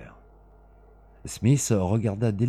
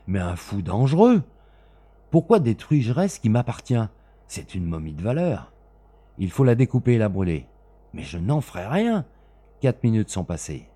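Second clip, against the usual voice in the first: a touch louder, higher protest; heated argument; 5 semitones higher, 6 dB louder than usual. Acted. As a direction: push back against the madman. Arguing under the gun.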